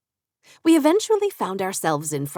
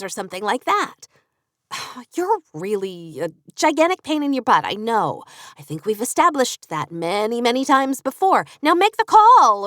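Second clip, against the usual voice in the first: higher, louder pushy friend voice, nagging; bossy best friend Katie; 5 semitones higher, 5 dB louder than usual. Acted. Katie bullies her friend into making the call, loud and bossy.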